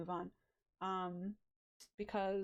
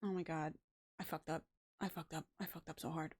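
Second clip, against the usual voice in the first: low, subdued self-reproach; softer, crestfallen admission; deflated regret; 4 semitones lower, 4 dB quieter than usual. Real, not acted. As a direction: sink down low and quiet in defeat. Regrets voice choice after realising.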